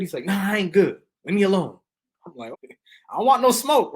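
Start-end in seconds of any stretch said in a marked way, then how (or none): 0.3-1.8 higher, louder, irritated push-away; an agitated man waving someone off; irate brush-off. Retold or imitated. Irate man voiced in story. 2.5-3.9 much higher and louder, mock alarmed; comic yelp of retreat; jokey backing off. Backing off jokingly from irate man.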